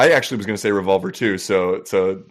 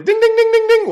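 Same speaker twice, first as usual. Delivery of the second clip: high sung bell chime; ding ding bell; 8 dB louder than usual. Pretend. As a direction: imitate a quiz show bell, loud and high. Mock correct-answer bell.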